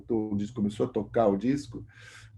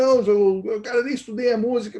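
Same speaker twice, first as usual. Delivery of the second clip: mock protest; 9 semitones higher, 3 dB louder than usual. Zé Barbeiro refusing, quoted.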